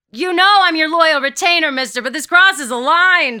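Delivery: high, loud indignant protest; shouting. A narrator's reading. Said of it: "shouts Peter".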